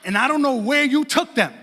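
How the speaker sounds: loud mock-furious telling-off, emphatic; imitating an outraged wife laying down the law; indignant wife. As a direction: build to a shouted accusation as the wronged wife. Wife's angry retold denial.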